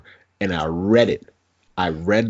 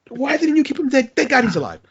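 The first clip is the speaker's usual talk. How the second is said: excited disbelief; 13 semitones higher, 4 dB louder than usual. Retold or imitated. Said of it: "I'm like".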